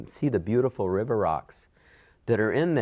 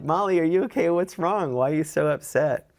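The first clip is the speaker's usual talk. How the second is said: higher and louder, full of worried concern; concerned questions; 9 semitones higher, 4 dB louder than usual. Retold or imitated. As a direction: rush to ask a shaken friend what's wrong. Worried friend asks upset owner.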